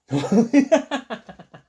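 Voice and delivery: loud, high-pitched burst of laughter. Pretend. Storyteller laughs at picture.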